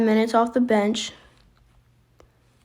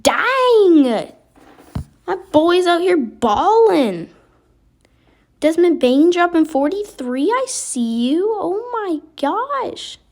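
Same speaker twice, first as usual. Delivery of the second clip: excited cheering; 6 semitones higher, slower than usual. Real, not acted. Star scores forty-three points.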